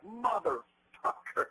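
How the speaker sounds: high, slowly stretched-out curse; startled angry curse. Retold or imitated. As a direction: howl the curse out in shock. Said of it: "and I was like".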